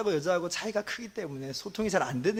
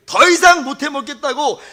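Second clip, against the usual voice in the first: louder and higher, a retold desperate outcry; a preacher reliving a man's shouting; retold yelling at God; 9 semitones higher, 12 dB louder than usual. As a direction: cry out loud to God in despair, as told in a story. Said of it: "하나님께 고래고래 소리를 질렀대요".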